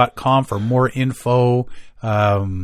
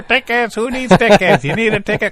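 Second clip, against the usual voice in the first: higher, loud hawking ticket seller; pushy ticket tout; 9 semitones higher, 3 dB louder than usual. Pretend. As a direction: bark it like a scalper at the door. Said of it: "You can sit at the front door".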